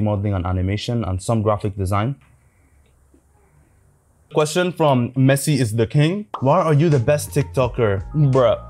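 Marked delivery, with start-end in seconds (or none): none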